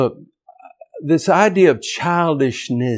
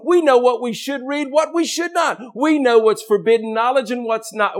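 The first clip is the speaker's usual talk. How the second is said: high-pitched, driving sermon cadence; impassioned preaching; 10 semitones higher than usual. Real, not acted. Preacher listing what God's children know, high impassioned preaching. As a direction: build a rolling list at full fervour. Preaching builds to full force.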